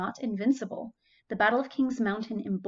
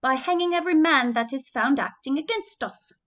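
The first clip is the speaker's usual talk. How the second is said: higher, louder mock British officer accent; 5 semitones higher, 7 dB louder than usual. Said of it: "That's my accent".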